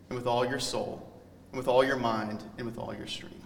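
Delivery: hushed recitation of scripture, dying to a breath; solemn scripture reading. Acted. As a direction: read the Shema like a sacred text.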